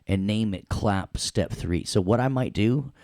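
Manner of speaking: ordinary talk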